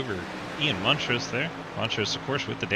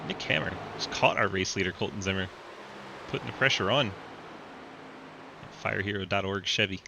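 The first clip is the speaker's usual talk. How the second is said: race commentary. Acted. Live race play-by-play.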